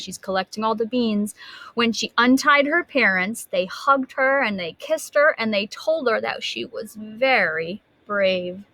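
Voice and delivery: usual speaking voice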